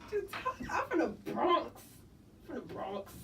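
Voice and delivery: hammed-up Bronx accent, breaking into laughter; New York accent. Shown as a demonstration. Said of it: "can you do a New York?".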